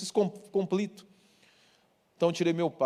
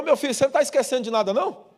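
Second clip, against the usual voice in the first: teasing father; 9 semitones higher, 5 dB louder, faster than usual. Retold or imitated. Father teasing son about gift.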